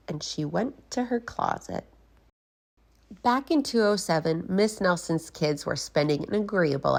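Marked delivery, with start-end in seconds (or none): none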